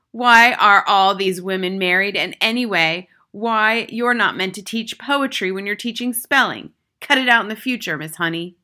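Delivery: loud, overbearing headmistress; loud, domineering telling-off; Trunchbull berating. A narrator's reading. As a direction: scold a teacher at full volume. Headmistress berating teacher in story.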